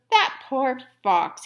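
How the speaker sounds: usual speaking voice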